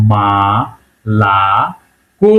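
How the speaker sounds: "মালাকো" drawn out very slowly, syllable by syllable, last o rising; syllable-by-syllable pronunciation. Shown as a demonstration. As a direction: stretch each syllable of "মালাকো", both first vowels as open "a". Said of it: "এটার উচ্চারণ করতে হবে".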